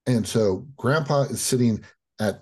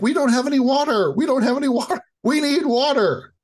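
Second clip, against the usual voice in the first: put-on high, loud, frantic children's cry for water; high frantic kids begging for water; panicked kids; 4 dB louder, faster than usual. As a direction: plead in a panic, voice way up. Kids panicking behind the door.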